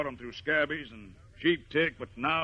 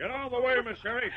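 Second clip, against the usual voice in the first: angry order; 8 semitones higher, faster than usual. Acted. Rancher orders the girl away from the dog, angry command. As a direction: bark it higher, a hard command.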